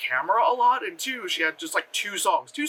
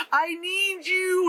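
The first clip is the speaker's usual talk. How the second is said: high, drawn-out mock movie yell; 10 semitones higher, much slower than usual. Imitating an actress yelling through the window, mock yell. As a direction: stretch it out like a bad actress calling. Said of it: "she's, like, yelling through the, the window".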